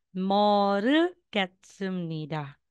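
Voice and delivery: Moro gusamnida, each syllable drawn out slowly; slow model Korean phrase. Shown as a demonstration. Teacher modelling Korean for students, slowly. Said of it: "So, ito po".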